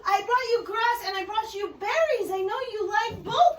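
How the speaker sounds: high, gushing animal-lover chatter; hammed-up excitement. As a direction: coo excitedly at a pretend animal, pitched high. Offers food to pretend buffalo.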